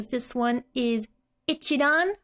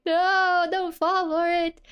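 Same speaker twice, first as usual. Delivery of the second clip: alarmed no; 7 semitones higher, 3 dB louder than usual. Retold or imitated. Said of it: "she's like".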